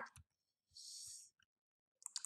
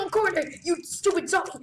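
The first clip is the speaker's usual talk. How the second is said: ordinary delivery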